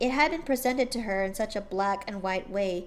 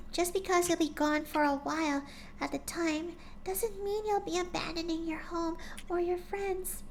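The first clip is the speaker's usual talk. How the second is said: higher, softer timid pony voice; gentle shy pony; 8 semitones higher, 5 dB quieter than usual. A narrator's reading. Shy pony gently coaxing her friend, soft and high. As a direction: speak like a shy gentle mare.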